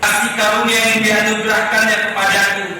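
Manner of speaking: loud, high-pitched preacher's proclamation; ringing pulpit declamation; full-force scripture preaching. Real, not acted. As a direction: proclaim the verse at full volume, drawing out key phrases.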